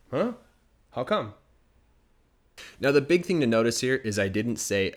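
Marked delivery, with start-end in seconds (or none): 0.1-1.3 surprised coworker. Acted. Coworker's reply, acted.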